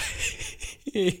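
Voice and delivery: high, soft whimpering sobs; acted sobbing. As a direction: whimper and sob weakly. Lone survivor sobbing in trench.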